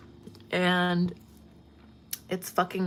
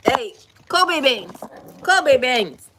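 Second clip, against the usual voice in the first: calling the dog; 5 semitones higher, 8 dB louder, slower than usual. Real, not acted.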